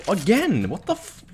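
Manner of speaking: high, exasperated cry; high frustrated yelp; frustrated outburst. Real, not acted. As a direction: whine at your own mistake. Frustrated at his own buy.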